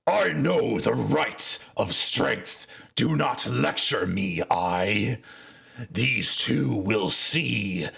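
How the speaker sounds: irritated orc chief. Acted. Chief to the seer, slow menacing irritation. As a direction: brush off the lecture and promise gore. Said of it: "irritated, Mubzak says".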